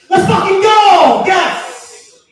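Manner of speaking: drawn-out, high victory yell; triumphant shout. Retold or imitated. Gamer to himself on beating the level, triumphant shout. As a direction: bellow it like you just won.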